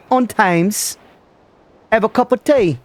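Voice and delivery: put-on amateur British accent; mock British accent. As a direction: play up a British caricature. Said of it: "My British accent".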